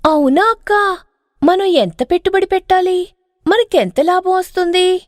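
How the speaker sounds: bright and greedy, leaning in; eager young wife. Acted.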